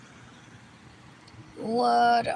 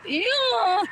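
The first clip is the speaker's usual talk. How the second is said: disgusted ew; 10 semitones higher, 4 dB louder than usual. Retold or imitated. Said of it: "And I'm like".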